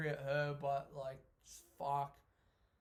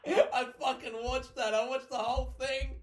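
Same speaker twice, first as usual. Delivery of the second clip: high, loud, through laughter; laughing hard; 8 semitones higher, 8 dB louder than usual. Real, not acted. Admitting to watching the outfit video, laughing hard. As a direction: confess it while cracking up.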